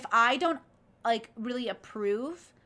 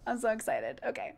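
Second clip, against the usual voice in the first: normal talking voice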